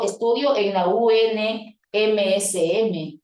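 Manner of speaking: "U N M S M" deletreado despacio, letra a letra; letra por letra. Shown as a demonstration. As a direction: deletrea "U N M S M" pausando cada letra. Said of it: "letra por letra". (sigla leída letra por letra)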